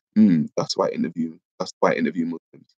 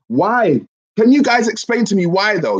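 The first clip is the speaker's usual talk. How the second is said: excited; 13 semitones higher, 4 dB louder than usual. Real, not acted. Pressing the audience for reasons.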